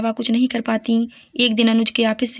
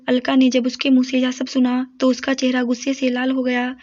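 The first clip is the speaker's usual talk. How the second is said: plain reading voice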